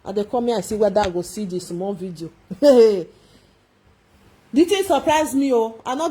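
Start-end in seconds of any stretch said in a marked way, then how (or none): 2.5-3.0 a burst of loud, real laughter. Real, not acted.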